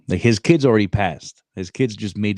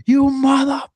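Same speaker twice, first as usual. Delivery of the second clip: high mock yell at the recruiter; imitating a furious gunny, pitched up; 5 dB louder than usual. Gunny on the phone, cussing out the recruiter, mock yell. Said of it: "My Gunny calls him".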